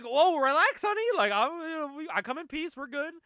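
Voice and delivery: high, loud mock backing-off; startled placating. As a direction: imitate someone hastily calming an aggressor. Retelling a startled woman placating Panda.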